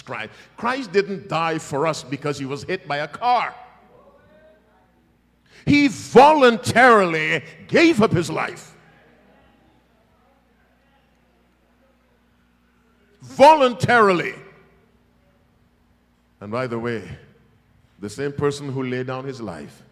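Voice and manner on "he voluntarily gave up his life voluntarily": loud, high proclamation of voluntarily; booming emphasis. Real, not acted. Preacher stressing the sacrifice to the church, booming. Preacher driving home the point.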